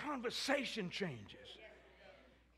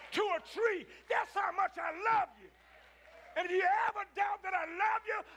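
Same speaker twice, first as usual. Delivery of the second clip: excited preaching; 5 semitones higher than usual. Real, not acted. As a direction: shout God's love to the crowd. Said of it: "I get excited when I talk about God's love".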